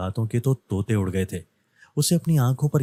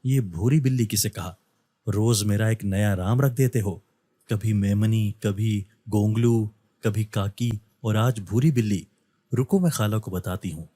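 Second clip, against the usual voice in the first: ordinary delivery, nothing marked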